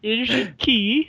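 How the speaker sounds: high, slow Scottish imitation; hammed-up Scottish host handing over a key; mock Scottish lilt, high and drawn out; Scottish landlady. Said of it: "but she said".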